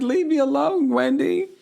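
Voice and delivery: high, whiny mock protest; mock whiny plea. Kay whining at Wendy, high mock protest. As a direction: whine it like a tipsy friend brushing someone off. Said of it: "she's like".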